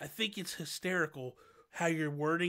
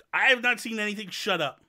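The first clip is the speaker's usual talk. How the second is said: higher, louder, exasperated retelling; mock exasperated retort; 7 semitones higher, 9 dB louder than usual. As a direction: snap back at a nagging friend, half joking. Acting out his impatient reply.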